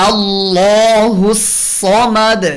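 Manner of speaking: slow, drawn-out chanted recitation; Quran recitation. Shown as a demonstration. Tajweed teacher reciting the verse as a model: slow Quran recitation. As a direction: recite it slowly and melodically.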